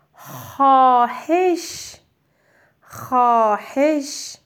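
very slow, drawn-out word; slow dictation. Shown as a demonstration. Teacher dictating خواهش slowly twice. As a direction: stretch each sound for dictation. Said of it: "کلمهٔ بعدی".

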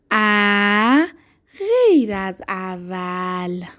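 ا غیر از اول said slowly with sounds drawn out; stretched dictation. Shown as a demonstration. Teacher dictating to young pupils, stretching each sound. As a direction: spell it out slowly for the class. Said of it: "صدا کشی یادت نره".

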